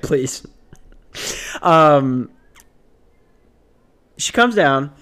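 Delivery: usual speaking voice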